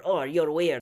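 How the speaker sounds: lightly imitated snide dig; mock snide remark. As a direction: mimic a dismissive little put-down. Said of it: "making a snide remark".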